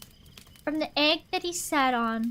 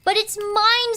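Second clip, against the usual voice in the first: loud, high protest; furious bird screaming; screamed; 7 semitones higher, 6 dB louder than usual. A narrator's reading. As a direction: shriek it as a claim. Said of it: "screamed the bird".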